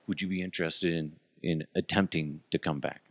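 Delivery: quiet, sly aside as if passing a secret; hushed coy offer. Retold or imitated. Host retelling the station's offer, hushed and sly. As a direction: murmur it like a wink-wink offer.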